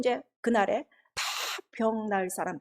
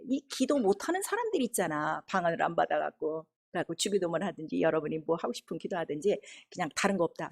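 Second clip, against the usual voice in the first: ordinary talk, nothing marked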